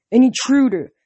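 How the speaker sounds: forceful growled alarm, punched loud. A narrator's reading. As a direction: growl it as an accusation. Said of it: "the general growled".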